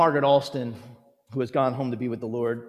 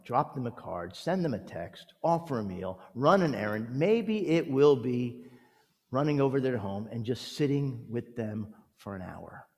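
normal talking voice

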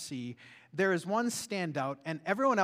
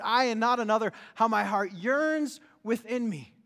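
preached scripture quote; 4 semitones higher, 5 dB louder than usual. Shown as a demonstration. Preacher proclaiming Job's hope to the congregation, ringing. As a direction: proclaim Job's words with fervour. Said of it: "said this".

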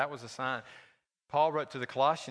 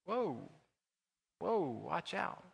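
lightly voiced wary dog, slow, drawn-out whoa; a dog backing off from the pack, gently mocked; dog's voice; 2 semitones higher, 6 dB quieter, much slower than usual. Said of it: "that dog will look and go".

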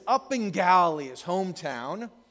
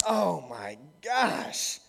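very slow, drawn-out fond groan; exasperated groan; much slower than usual. Retold or imitated. Jesus at his forgetful disciples, slow fond exasperation. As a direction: roll your eyes with your voice. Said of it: "you can picture him going".

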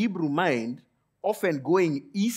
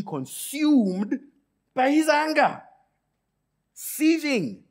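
slow build, then much higher and louder at the peak; building to seething; 12 semitones higher, 5 dB louder, much slower than usual. Acted. Preacher describing Jonah consumed by anger, rising to a high loud peak. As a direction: climb until the word bursts out high.

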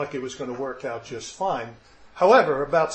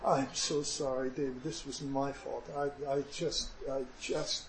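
low, very soft, halting remorse; retold tearful apology; 3 semitones lower, 10 dB quieter than usual. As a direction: murmur it brokenly, lightly acting tearful. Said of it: "And I was crying, and I was weeping".

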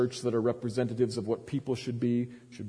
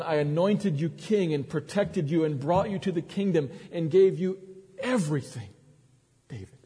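1 rising fervour; 6 semitones higher, 3 dB louder than usual. Acted. Preaching climax.

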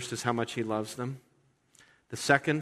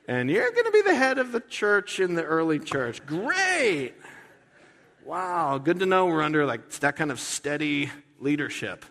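sarcasm; 5 semitones higher, 4 dB louder than usual. Acted. Sarcastic aside about Peter leading.

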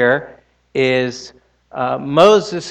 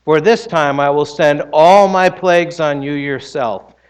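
louder, proclaiming scripture voice; scripture reading; 4 semitones higher, 6 dB louder than usual. Acted. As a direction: read the Lord's words with weight. Reads Exodus aloud in sermon.

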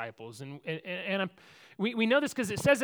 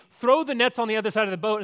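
called out, louder and quicker; mock yell; 4 semitones higher, 7 dB louder, faster than usual. Man on the beach to fishermen out in a boat, mock yell. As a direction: imitate a man yelling instructions from shore. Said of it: "this guy from the beach is yelling".

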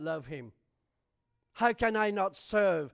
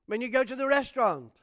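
pitched up, pressing the congregation; rising preaching; 5 semitones higher, 3 dB louder than usual. Acted. Pastor urging the church to serve openly, fervent preaching. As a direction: preach it, lifting each question.

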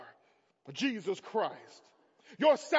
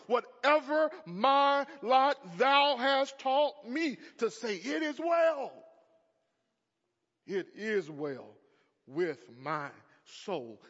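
preached hymn quote; slower than usual. Acted. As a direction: lift the hymn line up to the congregation. Quoting hymn in sermon.

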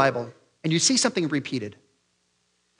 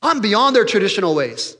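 high, loud, haughty scoff; smug dismissive Peter; 5 semitones higher, 6 dB louder than usual. Acted. Imagined Peter scorning religious people, haughty. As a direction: sneer at the church from above. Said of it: "Does he say".

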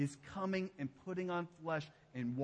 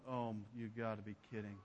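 scoffing onlooker; 8 semitones lower, 10 dB quieter, faster than usual. Acted.

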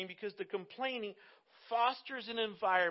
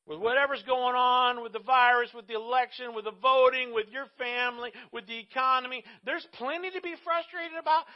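loud, punched list of troubles; 1 building emphatic list; 4 semitones higher, 9 dB louder than usual. Real, not acted. Preacher rallying the congregation, loud emphatic list. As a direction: hammer each item louder. Preacher listing current frustrations.

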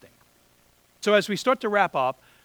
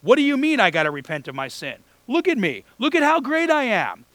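rising higher and louder, a hammed-up show-off; mock boastful Pharisee; 6 semitones higher, 5 dB louder than usual. Preacher mocking the self-righteous Pharisees, hammed-up boastful and high. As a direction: puff yourself up like a smug Pharisee. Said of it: "like the Pharisees, are like".

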